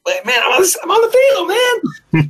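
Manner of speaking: high, hyped-up shout to friends; excited. Retold or imitated. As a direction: shout it out like you're thrilled. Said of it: "I text all my friends, like".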